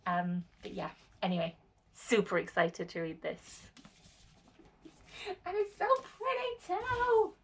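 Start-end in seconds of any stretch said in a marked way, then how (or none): 5.5-7.3 much higher, delighted gushing; high, thrilled gushing. Real, not acted. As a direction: coo over how pretty it is. Gushing over new book.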